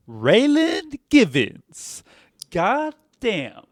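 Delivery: slow, drawn-out Southern Raylan Givens, god damn; Walton Goggins Southern accent. Retold or imitated. Doing Walton Goggins for the co-host, in a slow Southern accent. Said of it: "trying to be Walton Goggins in Justified".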